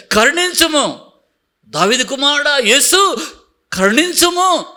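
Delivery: blind man crying out to Jesus. Retold or imitated. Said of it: "అని అడిగాడు".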